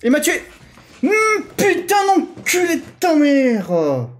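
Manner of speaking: furious drawn-out swearing. Real, not acted. Killed in game, swearing.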